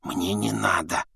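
soft, slow undertone; whispering. A narrator's reading. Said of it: "прошептал Рон". (шёпотом, вполголоса)

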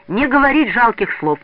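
high, loud, eager prompt; urgent boyish interjection; hurried prompt. A narrator's reading. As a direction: jump in with a quick suggestion. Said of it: "торопливо подсказал Петя".

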